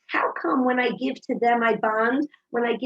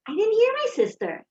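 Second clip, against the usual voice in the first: daughter; 11 semitones higher than usual. Retold or imitated. Daughter answers her mother.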